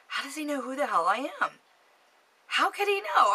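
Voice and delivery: high, fast mock-panicked questioning; retold alarmed disbelief. Caller wondering how Robert knows her, high alarmed disbelief. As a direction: let the pitch shoot up in baffled alarm. Panicked thoughts at being recognized.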